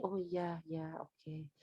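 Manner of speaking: very soft, low acknowledgement; understanding listener. Acted. Parent hearing out a child's reasons, quietly accepting. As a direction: murmur it back, low and accepting.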